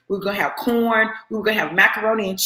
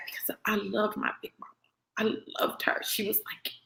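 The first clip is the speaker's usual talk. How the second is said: whispered, much softer, choked with feeling; emotional whisper; 12 dB quieter than usual. Real, not acted. Remembering her beloved Big Mama, a real emotional whisper. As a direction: let it drop to a whisper as the love overwhelms. Tender memory of her grandmother.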